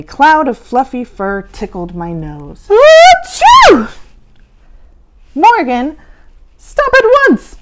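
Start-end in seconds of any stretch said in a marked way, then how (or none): 2.7-3.9 big exaggerated achoo; loud sneeze. A narrator's reading. Narrator acting the child's sneeze, loud. 5.3-7.6 high, loud stern command; ordering. Acted. Mister Z ordering Morgan to stop, high and loud. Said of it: "Mister Z ordered".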